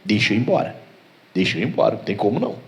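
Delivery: low, quiet retreating demon; soft, low-pitched giving up; defeated devil. Acted. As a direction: back away as a beaten devil, hushed. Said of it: "ele fala".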